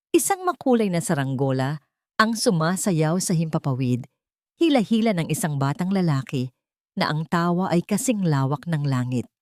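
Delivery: usual speaking voice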